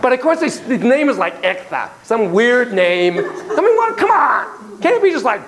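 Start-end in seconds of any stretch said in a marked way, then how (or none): none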